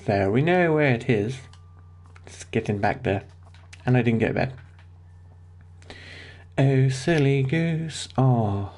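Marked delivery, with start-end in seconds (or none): none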